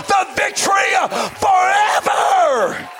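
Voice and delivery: high, shouted sermon build, slowing on forever; preaching at full force. Acted. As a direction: whoop it to the church like a revival preacher.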